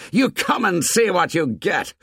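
high-pitched angry dare; heated, goading challenge; fighting taunt. A narrator's reading. Fighters goad each other.

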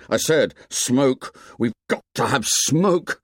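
a fierce, slowed insistence. A narrator's reading. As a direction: press it on the twins hard. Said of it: "He turned on the twins fiercely".

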